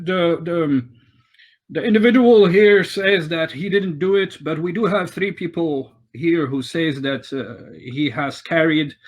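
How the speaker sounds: questioning witnesses in a lifted, formal voice; town master. Acted. Town master rules on the red brand, official and stern.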